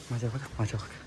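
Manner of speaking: low, soft hiding voice; soft low warning; hushed voice. Real, not acted. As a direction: keep quiet while hiding. Hiding, whispering.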